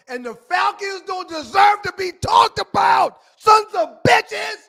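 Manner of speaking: angry shout. Real, not acted. Co-host fed up with discussing the Falcons, angry shouting. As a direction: shout it in exasperation.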